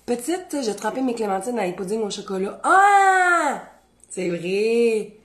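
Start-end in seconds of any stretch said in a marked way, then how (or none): 2.6-3.6 éclat aigu et fort; cri aigu. Real, not acted. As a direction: pousser un cri soudain. Sudden exclamation. (petit cri aigu)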